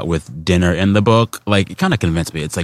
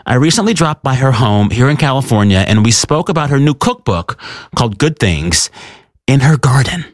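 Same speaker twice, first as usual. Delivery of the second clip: higher-pitched radio host introduction; host intro; 5 semitones higher than usual. Acted. Host reintroducing the guest to radio listeners, presenter voice.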